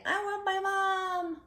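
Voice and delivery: high, squeaky scared piglet; scared little pig. Acted. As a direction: whimper like a frightened little pig. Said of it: "this little pig is saying".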